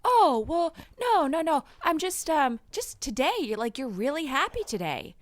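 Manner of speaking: put-on flustered kid.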